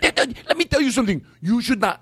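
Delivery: high, worked-up mimicry; imitating his voice. Comedian voicing the man lecturing a customer, high indignant imitation. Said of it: "do him doing that voice".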